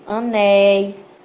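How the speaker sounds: "anéis" drawn out on open éi; slow teacher's "anéis" with long open é; open diphthong. Shown as a demonstration. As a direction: stretch the last syllable of "anéis", vowel wide open. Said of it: "eles são ditongos abertos".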